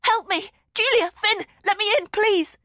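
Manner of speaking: high, loud pleading cries; begging to be let in, shouting; frantic shouting. A narrator's reading. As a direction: hammer on the door and yell for help. Banging on door, calling for help.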